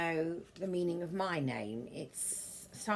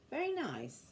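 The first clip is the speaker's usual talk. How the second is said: pleased exclamation; 8 semitones higher than usual. Real, not acted. Admiring a book, bright.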